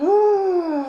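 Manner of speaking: high, soft exhaled groan; exasperated sigh. Real, not acted. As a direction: let out a long, pained sigh. Exasperated after the interception.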